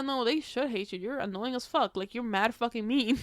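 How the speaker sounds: ordinary talk, nothing marked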